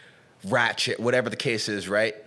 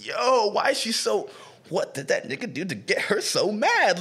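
high, drawn-out retold bafflement; baffled disbelief; 8 semitones higher than usual. Telling a friend why is she so mad, baffled. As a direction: retell being stunned, pitch lifted. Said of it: "And I'm just like".

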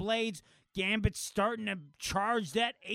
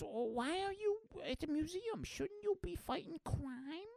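put-on little girl voice; 11 semitones higher, 7 dB quieter than usual. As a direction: ask it like a curious kid. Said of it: "little girl goes".

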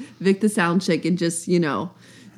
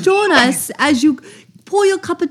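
put-on British nanny accent; 6 semitones higher than usual. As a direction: pour the tea like a prim British nanny. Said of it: "You sound like a British nanny".

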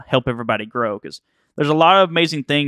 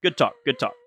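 dismissive catchphrase; 3 dB quieter than usual. Shown as a demonstration. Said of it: "something I say all the time".

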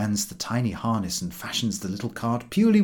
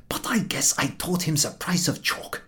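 cheerful dog; 5 semitones higher, 3 dB quieter than usual. A narrator's reading. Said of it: "he adds cheerfully".

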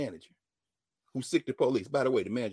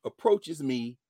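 ordinary talk

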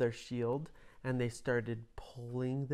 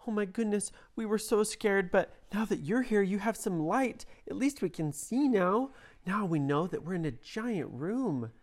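put-on much higher, relieved little children; high kid voices; 6 semitones higher than usual. A narrator's reading. Joseph and Isabella thanking the rescuers, high child voices. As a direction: sigh out relief as small kids.

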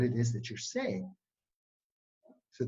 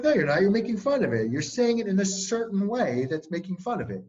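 put-on higher and louder, retelling a hurt kid's outburst; a retold heated accusation; indignant protest; 8 semitones higher, 5 dB louder than usual. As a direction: push back like he's insulting you. Upset kid accuses him of mocking.

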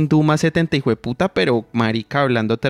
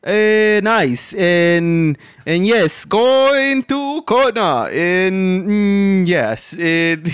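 slow, higher halting attempt at English; fumbling English; 5 semitones higher, much slower than usual. Imitating herself fumbling English on a phone call. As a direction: stumble searching for English words. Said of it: "¿yo cómo digo eso".

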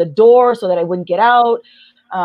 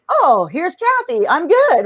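higher, slow, mock bright I'm-fine voice; fake cheery front; 7 semitones higher than usual. Hiding her trauma from everyone, mock cheery. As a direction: paste on a brave smile. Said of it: "put on my survival mode".

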